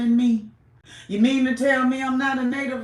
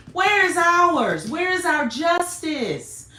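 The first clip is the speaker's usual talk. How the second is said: louder, drawn-out cry for justice; slow indignant demand; 6 semitones higher, 4 dB louder, slower than usual. Real, not acted. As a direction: demand it slowly and hard.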